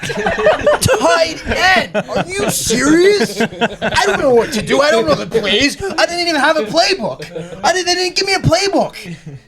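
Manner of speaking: high, laughing through the words; laughing disbelief. Real, not acted. Put in at tight end with no playbook, laughing disbelief.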